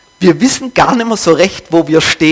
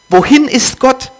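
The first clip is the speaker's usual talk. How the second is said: calling out; 7 semitones higher than usual. A narrator's reading. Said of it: "rief er".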